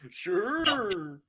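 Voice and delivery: high, loud goofy sure. Retold or imitated. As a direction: drawl it cartoonishly. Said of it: "Almost sound like Goofy".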